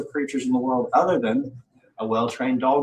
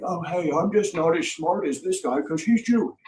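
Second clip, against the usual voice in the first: mock announcement; 8 semitones higher than usual. Mocking imagined speech to everybody.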